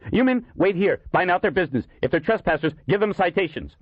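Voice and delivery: high-pitched flustered commands; tense high-voiced officer; urgent orders. Captain orders officers while fleeing.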